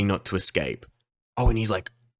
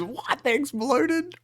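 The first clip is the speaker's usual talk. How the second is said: much higher, squeaky "what? they exploded?"; stunned squeal of disbelief; imitating a startled character in a high squeak; shocked disbelief; slower than usual. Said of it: "He goes".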